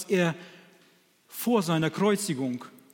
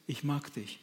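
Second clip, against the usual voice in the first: soft, simple confession; 2 semitones lower, 9 dB quieter than usual. Acted. Peter's simple plain words.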